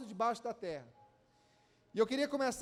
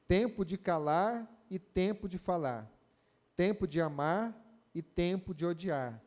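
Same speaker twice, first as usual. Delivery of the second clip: lower, measured Bible reading; scripture reading; 5 semitones lower than usual. Acted. Reads Ecclesiastes verses aloud.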